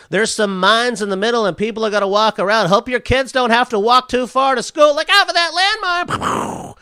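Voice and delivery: cheesy morning-show host voice; host a breezy traffic report; mock radio DJ.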